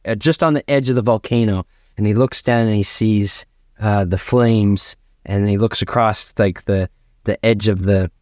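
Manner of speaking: ordinary talk, nothing marked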